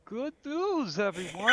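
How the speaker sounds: put-on high, loud, wavering old professor; Futurama professor impression. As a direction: shout it like a doddering professor. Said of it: "trying to do the Futurama".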